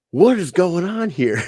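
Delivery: high, loud astonishment; completely floored; amazed. Retold or imitated. Sergeant amazed.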